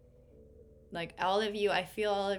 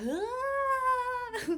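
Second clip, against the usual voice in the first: a sudden high, delighted cry of release; joyful exclamation; 6 dB louder than usual. Retold or imitated. Reader voices the open-armed figure on the card, joyful high cry. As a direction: voice the card figure flinging her arms open. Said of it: "she's just like".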